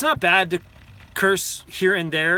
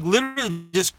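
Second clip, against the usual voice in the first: normal talking voice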